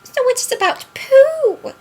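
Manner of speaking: loud, very high squeak; Piglet. A narrator's reading. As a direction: squeal it like a tiny storybook creature. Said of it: "he squeaked".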